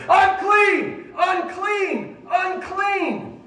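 high, ringing shout of a leper; loud high-pitched repeated cry; crying out a warning. Retold or imitated. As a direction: call it out to warn everyone off, drawn out.

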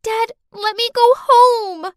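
high, loud, slow tearful plea; crying plea. Acted. Clarice to her dad, a high tearful plea. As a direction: beg through tears. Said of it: "as she was crying harder".

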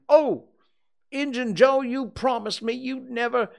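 high, loud desperate cry; anguished exclamation. A narrator's reading. Potter seeing Injun Joe, desperate exclaiming. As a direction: cry out, betrayed, and break off. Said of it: "and exclaimed".

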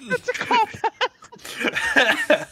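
"That's a callback" drawn out very slowly, high and laughing; slow laughing repeat. Real, not acted.

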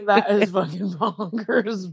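words broken by laughter; laughing. Real, not acted. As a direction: laugh through the line. Laughing at friend's story.

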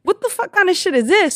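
high-pitched, incredulous demand; retold outraged confrontation. Teller confronts the girl over her address, high-pitched outrage.

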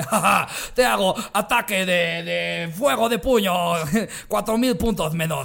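lento ataque exagerado de anime; otaku pendejo jugando. Acted. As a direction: imita a un friki anunciando su ataque, recalcado y lento. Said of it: "pendejos que están así como de". (voz burlona de otaku en duelo)

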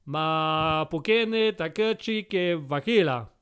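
slow gibberish in a hammed-up Italian accent; sing-song mock Italian, drawn out. Examiner rambles in hammed-up mock Italian. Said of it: "Tellement mélodieux".

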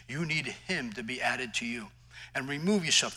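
ordinary delivery

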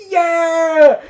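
high, loud imitated holler; mock crowd cheer. Audience answering the singer's question, imitated loud cheer.

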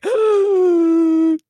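big loud high gasp; a dramatic loud gasp of alarm; shocked gasp. Acted. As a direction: gasp as if caught out. Shocked at own notes.

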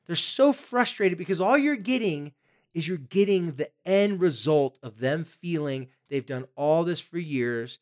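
ordinary talk, nothing marked

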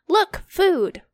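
a loud high call, pointing out food; excited shout. Acted. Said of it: "Any guesses who shouted that?".

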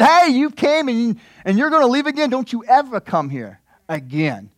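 a hint of the resentful brother; mild mock scolding brother. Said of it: "the brother that said".

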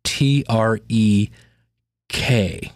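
slow, letter by letter; spelling it out slowly. Shown as a demonstration. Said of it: "now that's".

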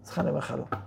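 soft apologetic refusal. Shown as a demonstration. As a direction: let him down softly, apologizing. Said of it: "אולי תתנצל, תגיד לו".

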